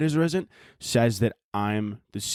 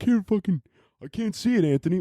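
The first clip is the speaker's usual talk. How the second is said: high, whiny put-on voice; mock complaining listener; 5 semitones higher than usual. Imitating a listener who can't see the sweatshirt, whiny. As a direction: whine it, pitched well up. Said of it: "anyone who's listening is gonna be like".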